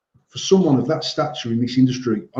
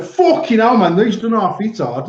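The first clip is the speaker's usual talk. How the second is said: mocking a clueless vaper; 7 semitones higher, 3 dB louder than usual. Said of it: "and stuck it on and went".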